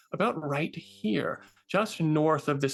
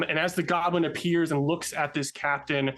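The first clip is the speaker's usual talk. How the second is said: ordinary talk, nothing marked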